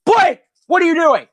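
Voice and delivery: annoyed driver calling out from the front; driver yelling. Retold or imitated. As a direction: snap at the kid like an angry driver. Bus driver yelling at kid.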